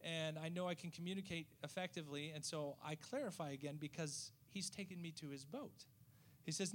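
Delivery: normal talking voice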